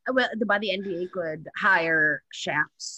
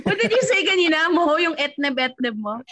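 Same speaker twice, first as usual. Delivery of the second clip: excited; 11 semitones higher, 6 dB louder than usual. Real, not acted. Excited laughing question.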